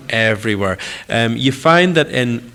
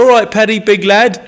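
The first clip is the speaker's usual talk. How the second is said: higher, louder imitation of the crew saying "big lad" in an English accent, the "lad" with a flat English vowel; 9 semitones higher, 6 dB louder than usual. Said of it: "it doesn't sound the same".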